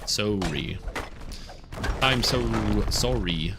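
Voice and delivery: drawn-out "sorry" as SORE-ee; Canadian pronunciation. Shown as a demonstration. Demonstrating how Canadians say it, drawn-out "sorry" as SORE-ee. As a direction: stretch "sorry" with a long rounded oh. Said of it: "Canadians say sorry".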